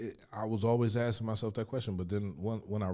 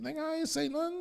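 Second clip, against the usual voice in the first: much higher put-on cellmate voice; voicing cellmate. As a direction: play the puzzled cellmate, pitched way up.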